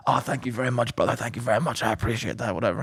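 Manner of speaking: soft, low imitation of a grateful passenger; retold modest thanks. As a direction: drop low and quiet to voice the thanks. Retells the flattered passenger's polite reply.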